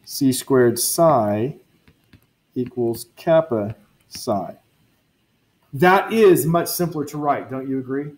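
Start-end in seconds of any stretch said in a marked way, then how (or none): none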